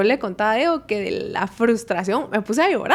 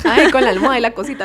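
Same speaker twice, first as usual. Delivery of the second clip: laughing; 6 dB louder, much faster than usual. Real, not acted. Teasing about crying into pillow.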